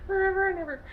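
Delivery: high, loud, gushing forever; giddy swoon. Acted. Recapping the romance to viewers, a high loud gushing squeal. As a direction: squeal it like a lovestruck fan.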